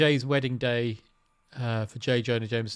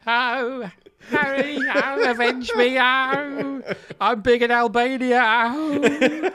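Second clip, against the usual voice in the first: put-on high, wailing slapstick old man; Norman Wisdom voice; 11 semitones higher, 6 dB louder, much slower than usual. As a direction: wail it high like a dying comic. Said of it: "Norman Wisdom".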